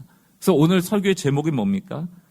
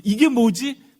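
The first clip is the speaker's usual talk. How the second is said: higher-pitched, marvelling question; exclaiming in wonder; 7 semitones higher, much slower than usual. Acted. Israelites learning to know God through manna, exclaimed wonder. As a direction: ask it amazed, pitch lifted. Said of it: "감탄할 수 있거든요".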